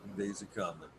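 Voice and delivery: repeated softly to self; quiet. Real, not acted. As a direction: murmur it, fading away. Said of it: "repeated softer".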